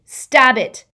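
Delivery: loud urgent order; harsh command. Acted. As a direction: bark the order. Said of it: "Harry said harshly".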